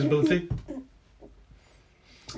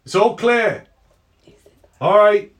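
higher, louder West Country call out the window; West Country accent by mistake; 10 semitones higher, 10 dB louder, slower than usual. Acted. Guard calling out the window to his friend, West Country accent. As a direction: shout the all-clear in a West Country accent. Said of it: "I've gotta get his accent right. He's not West Country".

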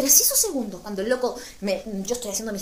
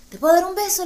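high, loud eager ask for a kiss; 8 semitones higher, 8 dB louder than usual. Retold or imitated. Asking the Korean guy for a kiss, eagerly. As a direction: blurt the question out, hopeful. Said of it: "le dije, andaba con ganas de dar beso".

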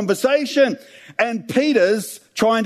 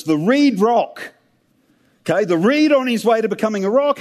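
usual speaking voice

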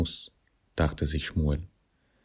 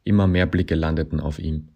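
usual speaking voice

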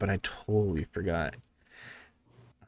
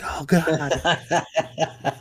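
mock scared; 8 semitones higher than usual. Scared passerby hearing whistling.

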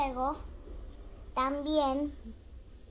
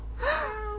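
jadeo de sorpresa; 11 semitones higher than usual. Acted. Mamá se sorprende.

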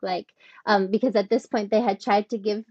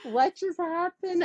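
high bewildered question; 9 semitones higher than usual. Retold or imitated. Retelling her own bewildered question.

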